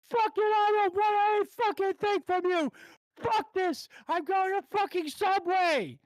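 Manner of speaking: very high, strained mock shout; retold angry shouting. Customer shouting at drive-thru worker, high furious rant. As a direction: blow up and storm off, voice way up. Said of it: "I'm just shouting at her".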